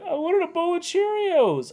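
put-on high, slow whine of a sobbing kid; whiny crying child. Mother imitates her crying daughter asking for cereal, high slow whine. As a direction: whimper it like a teary little girl. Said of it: "she's like".

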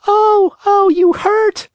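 very high, loud little cry of pain; tiny voice crying out. Acted. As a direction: yelp as the blow lands. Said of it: "cried the same faraway little voice".

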